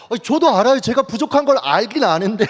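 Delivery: high, loud mock indignation; exasperated protest. Retorting to prayer that exposed him. (억울한 듯 높고 크게 항변)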